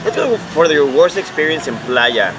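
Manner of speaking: normal talking voice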